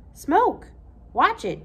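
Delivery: startled high loud shout; loud startled cry at the smoke; sudden cry. Acted. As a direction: blurt it out in alarm.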